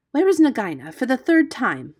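emphatic, irritated question; exasperated. A narrator's reading. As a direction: insist on an answer, out of patience. Impatient repeated question.

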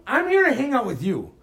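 ordinary talk

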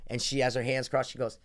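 normal talking voice

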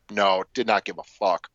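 normal talking voice

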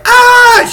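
very high shrieking scream; hammed-up high scream; mock scream. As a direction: shriek like a panicking girlfriend. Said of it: "the girlfriend is like".

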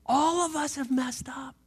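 breathy whisper, no voice at all. Shown as a demonstration. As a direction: let the words fall to a bare whisper. Preacher repeats line, second whispered.

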